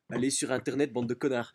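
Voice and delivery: loud mock insult to listeners. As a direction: bark it at the audience.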